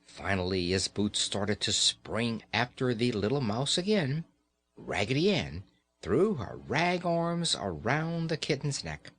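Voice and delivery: plain reading voice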